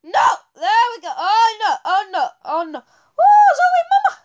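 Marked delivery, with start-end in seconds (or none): 2.8-4.2 very high, loud squeal of alarm; high-pitched yelp. Real, not acted. Player reacting to the game going wrong, high-pitched yelp.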